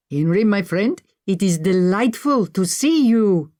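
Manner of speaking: put-on slow, delighted Indian-accented voice. A narrator's reading. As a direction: greet an old friend in a heavy Indian accent. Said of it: "His voice was heavy, with an Indian accent".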